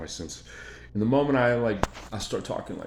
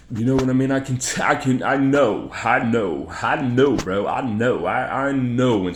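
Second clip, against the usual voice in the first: loud, insistent, higher-pitched burst; emphatic outburst; 5 semitones higher, 10 dB louder than usual. Real, not acted. Insisting he can spot a liar, loud and emphatic. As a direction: hammer it home, voice raised.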